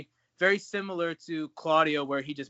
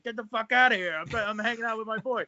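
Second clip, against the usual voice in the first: higher, raised-voice mock brush-off; Claudio shooing fans off; 6 semitones higher than usual. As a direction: wave fans away in a pitched-up imitation. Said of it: "He's like".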